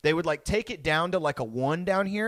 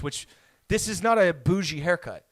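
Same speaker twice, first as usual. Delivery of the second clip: ordinary talk, nothing marked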